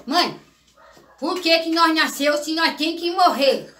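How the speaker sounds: put-on child asking. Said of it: "eu era uma criança muito inteligente".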